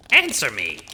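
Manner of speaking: loud, impatient order; shouted demand. Acted. Demanding the child answer, loud shout. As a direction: bark it at the screen.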